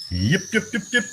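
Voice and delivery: high yapping little dog; high dog yips; yipping dog. Pretend. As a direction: yip like a tiny excited dog. Yapping again.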